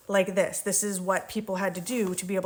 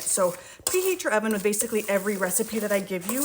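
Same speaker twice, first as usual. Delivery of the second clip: ordinary delivery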